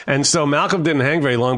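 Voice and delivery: ordinary talk, nothing marked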